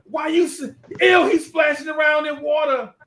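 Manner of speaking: high, loud grossed-out shriek; high disgusted yell. Retold or imitated. Lisa shrieking as he splashes, high and loud. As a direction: squeal at the splashing. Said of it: "she was like".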